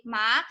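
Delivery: MA sliding upward; MA with a questioning rise; second tone. Shown as a demonstration. Teacher asks students to say ma, rising tone. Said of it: "This is the second tone".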